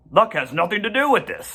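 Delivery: fast, brisk goose voice; proud goose. A narrator's reading. Goose corrects Charlotte in a quick, proud voice. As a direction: goose snaps back proudly.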